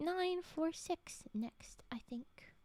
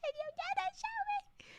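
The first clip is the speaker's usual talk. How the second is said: much higher squeaky mock outrage; hammed-up whiny complaint. Streamer fakes hurt at friend again, squeaky hammed-up whine. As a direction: overplay the betrayal, squeaky. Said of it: "I was gonna be like".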